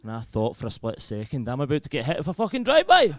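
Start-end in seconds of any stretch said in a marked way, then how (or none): 1.5-3.1 high, loud panicked yelp; rising to a loud, high wail; mock panic. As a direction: cry out in comic alarm. Comic panic at drive-by.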